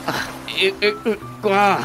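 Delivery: hesitant, broken syllables; flustered stammer. Acted. Husband cornered by his wife's accusation, stammering helplessly. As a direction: grope for an answer and fail.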